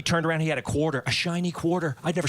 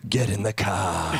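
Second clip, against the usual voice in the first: low, slow booming dad voice; Darth Vader with Boston accent; 9 semitones lower, slower than usual. Retold or imitated. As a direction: growl it like a menacing father. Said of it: "like Darth Vader with a Boston accent".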